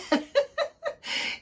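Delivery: a breathy giggle pitched high; high airy laugh. Real, not acted. To the viewers about the lady, a high airy laugh. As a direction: let a light laugh escape while telling a friendly story. Laughs at her own story.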